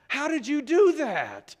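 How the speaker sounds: high, loud, slow "How did you do that"; amazed. Retold or imitated. President stunned by the fix, asking loud and slow. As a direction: demand it slowly, in loud amazement.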